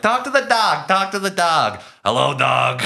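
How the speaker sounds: high-pitched flirty mock voice; imitating Sylvia Miles. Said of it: "and then she's like".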